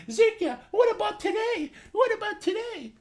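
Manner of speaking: very high, squeaky mock fan; pestering fan imitation. Streamer mocks fans nagging about today, pestering fan imitation. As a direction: imitate a nagging viewer in falsetto.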